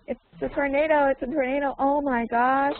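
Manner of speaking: American guy impression. Teacher echoing student's impression of American guy, mock amazement. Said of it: "who can do an impression of it?".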